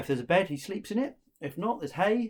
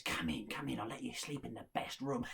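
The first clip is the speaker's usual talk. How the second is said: lower, soft coaxing voice; scheming innkeeper; 5 semitones lower, 8 dB quieter than usual. Acted. Innkeeper invites traveller to best room, sly and soft. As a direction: usher them in softly and slyly.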